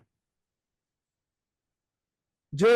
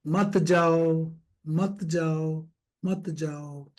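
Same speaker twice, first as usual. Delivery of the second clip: very slow, each repeat fading softer; drawn-out plea; 3 semitones lower, 4 dB quieter, much slower than usual. Shown as a demonstration. Coach urging shy students not to go, drawn-out fading plea. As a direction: stretch every word, let it die away.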